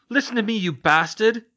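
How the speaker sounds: loud, high furious yell. Acted. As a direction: scream it in his face. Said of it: "he yelled".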